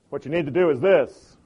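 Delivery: lecturing a friend bluntly; not so gentle. Pretend. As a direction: tell her what to do, blunt. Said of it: "That's kinda not so gentle".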